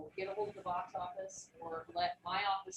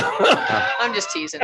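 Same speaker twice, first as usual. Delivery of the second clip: laughing; 4 semitones higher, 16 dB louder, much slower than usual. Real, not acted. Teasing board member, laughing.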